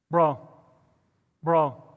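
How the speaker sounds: ordinary delivery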